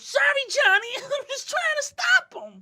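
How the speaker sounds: high, laughing, sheepish voice; sheepish apology. Acted. Teammate apologizing to Johnny for the car, high and sheepish. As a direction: squeak out a guilty sorry.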